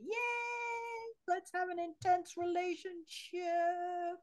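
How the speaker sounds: high, gushing, overeager cheer; gushing excited voice. Acted. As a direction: squeal it like a thrilled fan. Voicing the eager Scorpio crowd.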